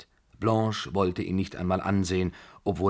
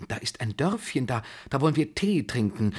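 higher, flustered coaxing; anxious, grasping suggestion; desperate; 6 semitones higher than usual. A narrator's reading. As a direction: plead on desperately. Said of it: "fuhr der General verzweifelt fort".

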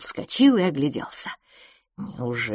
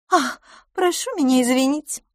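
higher, timid little apology; 9 semitones higher, 3 dB louder than usual. A narrator's reading. The scolded girl apologises to her fiancé, timidly and higher. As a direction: shrink back and apologise higher. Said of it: "сказала мисс Лафос робко".

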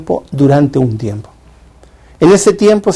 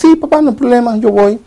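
put-on eager teenage son; 8 semitones higher than usual. Teenage son answers his father.

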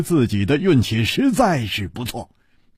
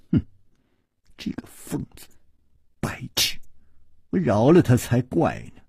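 hushed, venomous grumble; hateful muttering; 3 semitones lower, 3 dB quieter than usual. A narrator's reading. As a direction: curse him under the breath, then threaten. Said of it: "恨恨地说". (恨恨地低声咒骂)

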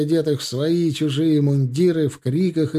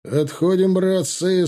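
higher-pitched retreat call; commanding; 6 semitones higher, 3 dB louder, slower than usual. A narrator's reading. Alexei orders his comrades to withdraw, commanding. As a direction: lift the voice to rally the men back. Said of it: "скомандовал Алексей".

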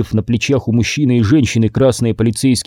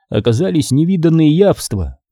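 ordinary delivery, nothing marked

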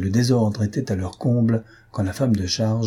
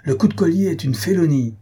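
higher, louder outraged protest; indignant cry; 7 semitones higher, 3 dB louder than usual. A narrator's reading. Desrets protesting the neck hold during the wrestling, outraged. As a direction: cry foul at the cheat. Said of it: "lui criait Desrets".

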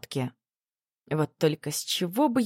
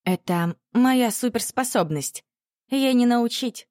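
smiling; 7 semitones higher than usual. A narrator's reading. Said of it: "улыбнулась Аня".

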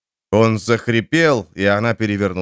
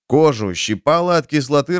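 plain reading voice

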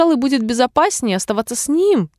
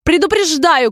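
high, moderately raised imperious cry; shouted warning; 8 semitones higher, 3 dB louder than usual. A narrator's reading. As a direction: call out a royal warning. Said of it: "крикнула королева". (повелительный окрик)

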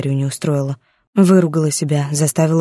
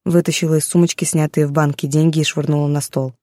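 ordinary delivery

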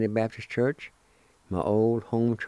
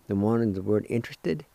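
normal talking voice

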